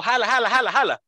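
urging someone to pull, jala jala; calling out orders. Retold or imitated. Speaker imitates someone urging another to pull, called out. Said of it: "le está dando una instrucción a otro".

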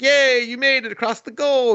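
very high, hammed-up cheer; mock cheering. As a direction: celebrate in a high imitation voice. Cheering him at the goal.

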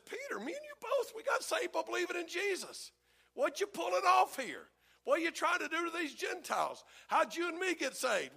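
high, indignant rebuke that rises to a shout; a voiced apostle scolding his friend; Paul rebuking Peter. Paul calling out Peter to his face, high and indignant.

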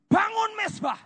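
high ringing shout. Acted. Preacher shouting to build the altar, high and ringing. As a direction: cry out the command.

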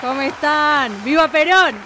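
high, loud rally cry; rally shout. Acted. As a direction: shout it to a plaza crowd. Evita costume, rallying the crowd.

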